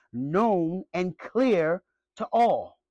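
very slow, each word weighed; "known and clear to all" drawn out slowly with a fall on "clear"; slow emphatic line. Real, not acted. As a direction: lay it down deliberately. Preacher drawls key phrase.

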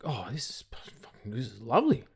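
whispered savouring; dad whispering over dessert. Retold or imitated. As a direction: breathe it out in delight. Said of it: "he's like".

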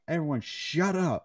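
half-earnest shout for silence; imitated screaming. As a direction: imitate someone yelling over a crowded room. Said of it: "Stamper screaming in the background".

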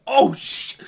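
high, loud panicked yelp; panicked mock yelp.

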